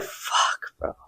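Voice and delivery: breathless whispered swear; whispered curse. Retold or imitated. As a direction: let it slip out on the breath. Realising she got caught.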